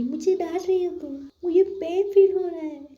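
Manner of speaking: high, whiny mimicry of a crybaby; mocking whine. Saili mocks Anjali's complaint in a high whiny voice. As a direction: mimic her whining to tease her. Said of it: "आई बड़ी कह रही".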